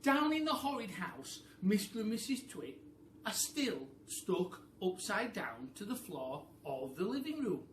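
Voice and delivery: a loud, dramatic reading; dramatic narration. A narrator's reading. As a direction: tell it like a spooky tale.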